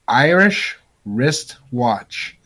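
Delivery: "Irish wristwatch" word by word, slowly; slow, spaced out. Shown as a demonstration. Host setting a tongue-twister challenge, "Irish wristwatch" said slowly. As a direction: lay out "Irish", then "wristwatch", with gaps. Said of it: "As fast as you possibly can".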